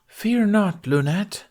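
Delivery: high, loud stage knight; heroic declaration. A narrator's reading. Knight reassures the accused Lunette, declaring high and loud. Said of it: "Tooth declared".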